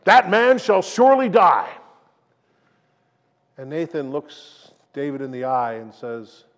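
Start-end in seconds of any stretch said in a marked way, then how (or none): 0.1-1.7 loud and higher, pronouncing a death sentence; outraged verdict. Acted. King David condemns the rich man in outrage, loud. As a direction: let the king's outrage burst out. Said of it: "David is outraged".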